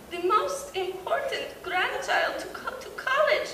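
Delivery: lightly voiced grandma, slow and high; imitating grandma. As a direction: coo it like a proud grandmother. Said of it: "Then enters Yaya".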